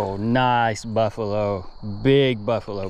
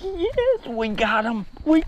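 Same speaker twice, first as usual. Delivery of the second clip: shrieked, very high cheer with laughter; triumphant yell; slower than usual. Real, not acted. Angler squealing in triumph as he lands the carp. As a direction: squeal with joy at the catch.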